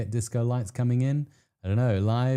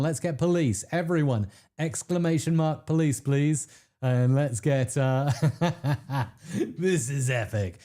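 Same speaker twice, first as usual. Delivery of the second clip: normal talking voice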